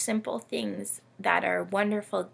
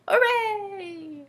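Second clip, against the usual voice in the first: much higher, sung-out cheer; happy hooray; 13 semitones higher than usual. A narrator's reading. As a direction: cheer it like a delighted child. Camel cheers in story.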